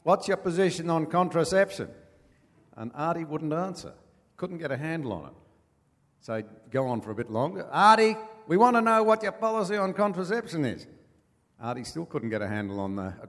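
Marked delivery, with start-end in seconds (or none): 0.0-1.9 yelled up high from the back of the crowd; retold heckler shouting at a campaign speech; heckler yelling. He yells out. 7.7-10.9 loud, high demanding yell; heckler shouting. Retold or imitated. Heckler pressing the politician.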